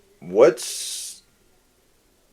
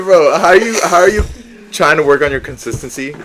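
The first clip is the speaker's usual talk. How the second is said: mocking imitation; 5 semitones higher, 8 dB louder than usual. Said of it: "He said".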